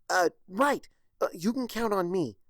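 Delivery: high, loud and flustered; flustered yelp. Acted. Twilight answering the princess's order, flustered and high. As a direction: blurt it out in a panic.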